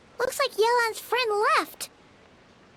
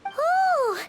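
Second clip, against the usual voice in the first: a high, bright ooh of delight; light up at the discovery; excited ooh; 7 semitones higher, 4 dB louder than usual. Acted. Paimon spotting Yelan at a tea party, excited.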